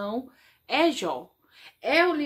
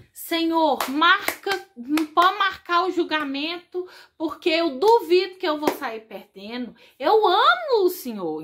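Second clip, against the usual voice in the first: loud, higher, confident challenge to the Lord; bold plea to God; 5 semitones higher, 6 dB louder than usual. Acted. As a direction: demand the trial boldly, sure of winning. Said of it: "De eu falar assim".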